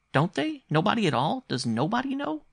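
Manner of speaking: put-on very high, incredulous child; astonished boy. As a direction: ask in high-pitched childish disbelief. Boy astonished, presses his mother.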